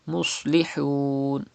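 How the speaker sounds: Muslihun slow with a thick sad and throat ha; heavy sad. Shown as a demonstration. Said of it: "sad is a mustaliya letter".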